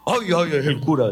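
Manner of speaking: put-on a touch of a frightened drunk fleeing; mock fright. Drunk running from the priest, a hint of panic. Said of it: "decía".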